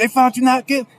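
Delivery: high, loud amazed exclamation. Retold or imitated. Roommate repeating the verdict back, amazed. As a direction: blurt it out in delighted disbelief.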